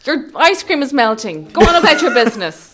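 loud, slowed-down telling-off; mock-stern, laughter underneath; scolding dismissal. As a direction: send them packing. Retelling dismissing men with ice creams.

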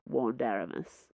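ordinary delivery, nothing marked

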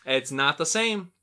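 ordinary talk

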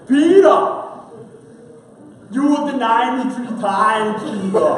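loud cartoon strongman Jesus; exaggerated cartoon intonation. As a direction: boom it out like Arnold. Jesus quoted in Arnold voice.

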